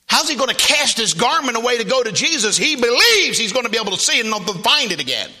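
high, loud sermon delivery; fervent preaching. Acted. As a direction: press the point on the congregation. Preaches at full force.

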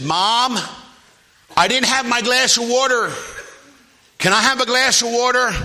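put-on high, loud little boy calling Mom; acted-out child's holler; yelling downstairs. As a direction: call down to another floor. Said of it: "he yelled down, he said".

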